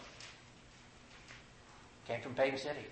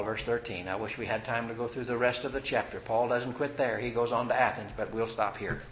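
usual speaking voice

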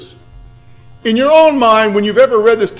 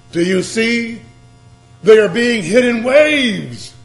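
raised, driving preacher voice; higher, urgent sermon delivery; forceful preaching; 6 semitones higher, 4 dB louder than usual. Real, not acted. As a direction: build the pile-up, landing hard on key words. Preaches emotions piling up.

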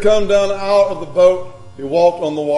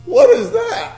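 very high, put-on little cry; high-pitched pretend fright; mock mild alarm; 5 dB louder, much slower than usual. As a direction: squeal it daintily, mocking a feeble reaction. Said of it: "do you think they were just going".

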